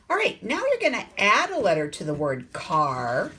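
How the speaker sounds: ordinary talk, nothing marked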